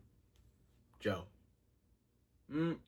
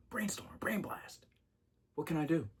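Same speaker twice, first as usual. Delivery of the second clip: whispered idea; 7 semitones higher, 3 dB quieter than usual. Real, not acted. Sudden idea.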